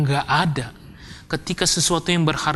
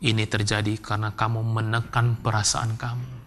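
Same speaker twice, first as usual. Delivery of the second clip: low, soft, measured counsellor voice; quiet, lower-pitched, careful delivery; counselling lecturer; 6 semitones lower, 6 dB quieter than usual. Retold or imitated. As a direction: gently explain to a grieving student. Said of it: "Terus dosen saya bilang".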